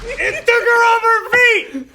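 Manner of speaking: high, loud astonished exclamation; real excited yell; amazed outburst. Real, not acted. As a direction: burst out in disbelief at the action. Amazed reaction to film moment.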